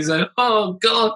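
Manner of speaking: ordinary delivery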